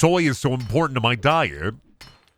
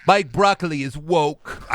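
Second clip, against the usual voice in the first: mock anti-soy guy; 5 semitones higher than usual. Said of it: "That's like".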